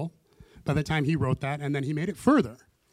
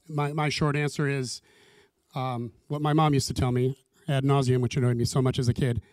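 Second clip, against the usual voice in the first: normal talking voice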